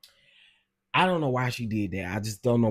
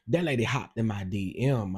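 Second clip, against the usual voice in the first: ordinary talk, nothing marked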